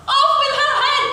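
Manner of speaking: high, loud royal command; prince's furious high shout; roaring. A narrator's reading. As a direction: roar it as an execution order. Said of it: "the prince roared back".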